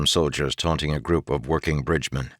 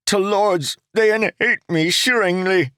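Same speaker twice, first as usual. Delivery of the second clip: groaning complaint; 13 semitones higher, 4 dB louder than usual. Acted. Young Keeg groaning to Jarek about his loss. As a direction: drag out the protest like a wounded boy. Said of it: "Keeg groaned".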